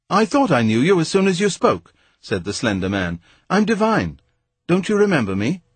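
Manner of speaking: a hint of a mocking toff; lightly voiced Devine. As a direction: play the smug, chummy schoolmate.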